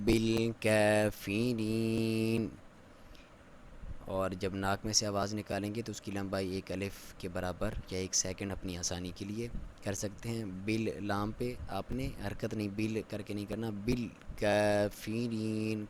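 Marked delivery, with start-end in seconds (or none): 0.0-2.5 slow drawn-out tajweed chant; Quran recitation. Shown as a demonstration. Teacher recites the verse for students, slow chant. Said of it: "کاف کے اوپر باریک پڑھیں گے اسے". 13.8-15.8 slow drawn-out tajweed chant; Quran recitation. Shown as a demonstration. Teacher recites the verse for students, slow chant. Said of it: "کاف کے اوپر باریک پڑھیں گے اسے".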